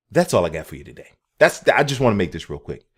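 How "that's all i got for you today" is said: usual speaking voice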